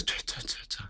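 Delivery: whispered flustered tuh sounds; mock a speechless candidate with breathy tuhs; stammering tuh. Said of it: "she goes".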